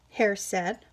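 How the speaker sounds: ordinary delivery, nothing marked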